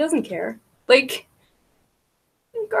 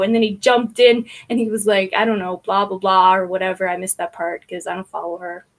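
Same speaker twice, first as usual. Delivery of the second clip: ordinary talk, nothing marked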